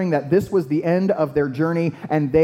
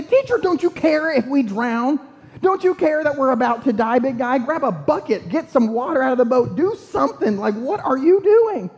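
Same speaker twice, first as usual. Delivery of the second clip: panicked, impolite; 9 semitones higher than usual. Acted. Frightened disciples to sleeping Jesus in a sinking boat, panicked and rude. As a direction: yell at him in exasperated panic. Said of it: "in a less than polite format because they are afraid".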